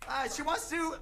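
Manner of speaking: ordinary delivery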